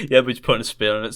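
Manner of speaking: usual speaking voice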